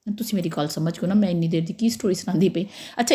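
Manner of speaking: ordinary delivery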